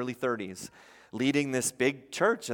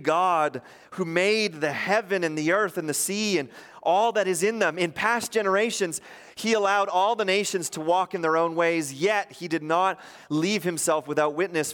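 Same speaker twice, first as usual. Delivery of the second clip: higher, louder scripture declamation; scripture reading; 4 semitones higher, 3 dB louder than usual. Acted. Pastor reading Paul and Barnabas to the crowd. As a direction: proclaim the verse.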